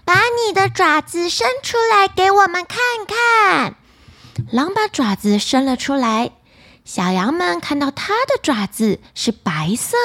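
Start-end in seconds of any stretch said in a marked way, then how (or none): 0.0-3.8 put-on high, loud demand from the kids; children yelling, pitched up; little goats calling out. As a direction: shout a challenge at the wolf. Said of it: "小羊们叫道".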